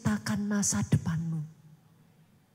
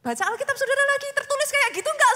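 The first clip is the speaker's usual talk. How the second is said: ordinary talk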